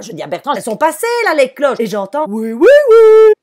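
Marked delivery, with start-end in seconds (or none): 0.6-1.8 high, loud call outside; calling out to her husband; yelling out the window. Retold or imitated. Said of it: "je gueule, quoi". 2.3-3.3 very high, loud, slow chirping yes; hammed-up chick voice answering from outside; silly chick voice. Chick costume answers, drawn out.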